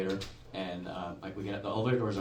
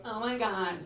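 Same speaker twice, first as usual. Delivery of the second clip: put-on high, loud, dragged-out girl voice; alarmed teenage girl; 7 semitones higher, 4 dB louder, slower than usual. High school girl tells bros about creepy follower, alarmed. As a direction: play a scandalised girl, pitched up.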